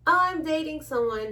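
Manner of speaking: ordinary delivery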